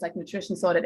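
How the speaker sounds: ordinary delivery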